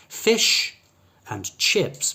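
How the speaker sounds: "Fish" punched high, short I in "Fish" and "chips"; short relaxed vowels in "Fish and chips". Shown as a demonstration. Teacher pointing out the vowel to learners, short I sound. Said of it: "both have a short I sound".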